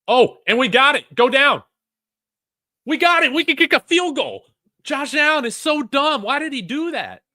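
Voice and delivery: high, loud, giddy shouting; excited yelling. Real, not acted. Fan shouting at the fumble, high and loud. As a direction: yell in disbelief at the turnover.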